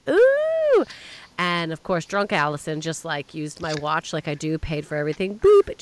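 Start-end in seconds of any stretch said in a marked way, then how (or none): 0.1-0.8 high, loud delighted ooh; excited ooh. Retold or imitated. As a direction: squeal with delight at the find. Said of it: "and I was like".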